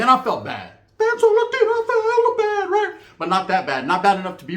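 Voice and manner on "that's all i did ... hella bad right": hammed-up high wail of regret; mock whine. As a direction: whimper it like a guilty child. Mock whining about feeling bad.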